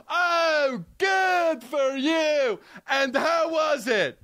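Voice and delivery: high, slow, sugary put-down; mock patronizing. Mocking the patronizing line, high and drawn out. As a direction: stretch out the good like talking down to a child. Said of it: "So patronizing".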